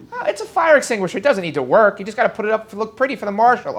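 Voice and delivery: hammed-up flippant excuse; mock sarcastic. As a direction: toss it off like a cheeky know-it-all. Mocking voice after complaints.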